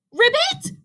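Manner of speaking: very high, incredulous question; cried out. A narrator's reading. Rabbit throwing the frog's word back, crying out. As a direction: squawk it in disbelief. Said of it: "cried Rabbit".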